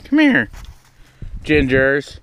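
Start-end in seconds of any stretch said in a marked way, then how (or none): 0.0-0.5 high call falling away; calling an animal. Real, not acted. Calling Ginger over.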